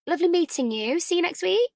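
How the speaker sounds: high, airy socialite farewell; breezy goodbye. A narrator's reading. Nora breezily saying goodbye to Ellie, airy. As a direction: brush her off brightly.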